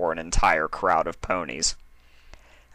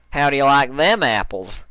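cocky Applejack voice, a bit higher; 6 semitones higher, 6 dB louder than usual. Acted. Applejack bragging to Rainbow, smug country voice. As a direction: show off with a grin in the voice. Applejack brags after trick.

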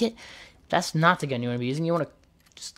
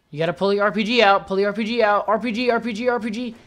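rising, urgent calls at the screen; excited urging; 4 semitones higher than usual. Real, not acted. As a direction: egg the player on, higher each time.

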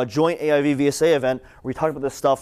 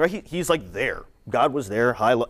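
ordinary talk, nothing marked

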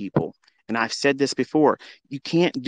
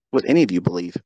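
usual speaking voice

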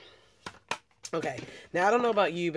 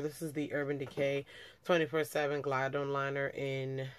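ordinary talk

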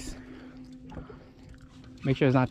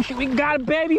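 ordinary talk

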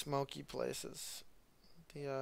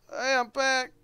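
revived clone; 10 semitones higher, 8 dB louder than usual. Retold or imitated. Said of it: "and then he just".

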